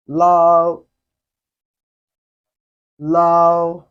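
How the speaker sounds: MA, BA, YE, LAO each held high and level; steady upper-range pitch on MA, BA, YE, LAO; first tone. Shown as a demonstration. Teacher gives examples of the high level tone. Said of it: "This tone can also be called the flat tone".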